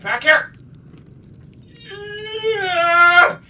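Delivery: loud, high, drawn-out come on; frustrated shout. Real, not acted. Player chasing the kid, shouting in frustration. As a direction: yell in real frustration.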